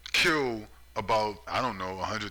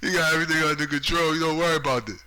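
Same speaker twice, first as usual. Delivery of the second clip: louder, higher sneering parody of the line; mocking repeat; 6 semitones higher, 6 dB louder, faster than usual. Ranting at a politician, a loud sneering parody. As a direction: throw his own words back at him, mocking.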